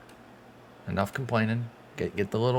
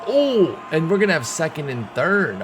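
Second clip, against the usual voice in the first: much higher and louder burst of delight; excited; 7 semitones higher, 5 dB louder than usual. Real, not acted. Fan cheering an error for his team, excited yelp. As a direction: jump on the lucky break.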